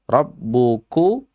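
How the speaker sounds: Rabbuku drawn out slowly, ku light; Quran pronunciation. Shown as a demonstration. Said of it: "kunya itu dalam posisi dhammah". (Rabbuku dibaca pelan, ku ringan)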